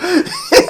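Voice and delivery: loud, very high-pitched cackle; real high laughing fit. Real, not acted. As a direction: burst out laughing helplessly. Cracks up at own story.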